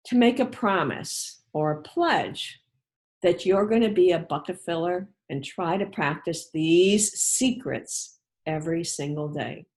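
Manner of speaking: ordinary delivery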